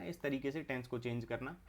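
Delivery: usual speaking voice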